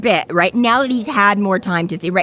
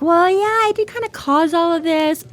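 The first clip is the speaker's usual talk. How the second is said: much higher, sheepish put-on voice; sheepish Harry; 9 semitones higher than usual. Harry half-admitting he started the war, high sheepish voice. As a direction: own up with an awkward high shrug.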